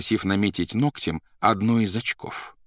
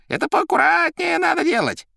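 very high, louder voice; high character voice; 6 dB louder than usual. A narrator's reading. Character chiding someone, high and loud. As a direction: scold lightly in a high voice.

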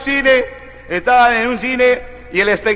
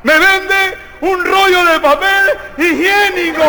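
high, slow, heavily nasal demand; exasperated comic nasal voice; angry nasal man; 8 semitones higher, slower than usual. Acted. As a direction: honk it out through your nose, fed up. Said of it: "enojado, el gangocito viene con una bolsita".

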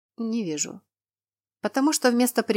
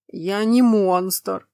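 slow, louder, hurt rumble; offended giant; 6 dB louder, much slower than usual. Acted. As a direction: drag it out, wounded. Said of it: "Нотки обиды промелькнули в голосе циклопа".

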